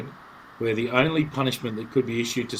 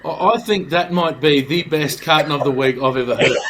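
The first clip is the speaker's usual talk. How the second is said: laughing; 5 dB louder than usual. Real, not acted. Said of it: "laughing after the story".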